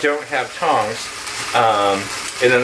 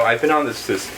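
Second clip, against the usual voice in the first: ordinary talk, nothing marked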